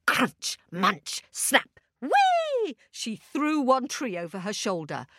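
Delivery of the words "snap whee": squealed whee much higher. Acted. Witch tossing a tree with a high whee.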